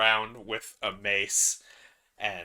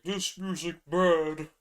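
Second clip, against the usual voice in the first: slow, loud, simple giant; dumb giant voice; 6 semitones higher, 5 dB louder, slower than usual. Acted. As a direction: groan it like a brutish giant. Giant covering ears.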